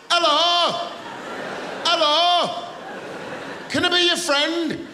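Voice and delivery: put-on overeager friendly Leeds accent, pitched high. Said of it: "they talk like that".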